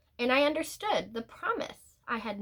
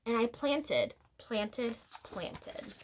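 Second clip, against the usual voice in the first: each planted softer until it is barely whispered; fading repetition; 6 dB quieter, slower than usual. A narrator's reading. Storyteller fading out the repeated planting. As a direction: let the word die away, planted, planted, planted.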